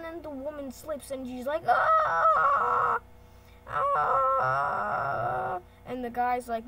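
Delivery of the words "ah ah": long, loud, high cartoon scream; hammed-up scream. Stickwoman slipping on a banana peel, mock scream. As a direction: wail it out as if falling. Woman slips, acted scream.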